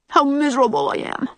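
put-on high, quick little-boy lament; lightly acted misery of a small boy; miserable child. A narrator's reading. Upset boy confessing at night.

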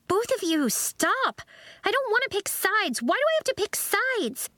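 very high, overwrought plea; distressed outburst. A narrator's reading. Zinnia is forced to pick sides, distressed. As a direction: protest in a high-pitched, upset voice. Zinnia torn between both friends.

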